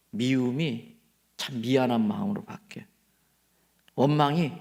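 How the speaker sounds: usual speaking voice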